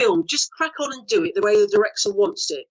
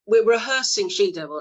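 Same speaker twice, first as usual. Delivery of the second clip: usual speaking voice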